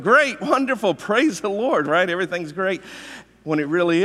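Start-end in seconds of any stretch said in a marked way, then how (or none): 0.0-1.9 high, bright, overly upbeat reply; fake cheerful. Churchgoer faking joy to a greeter, overly cheerful. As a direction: put on a big happy church face. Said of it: "You walk into church".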